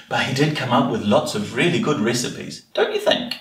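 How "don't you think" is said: plain reading voice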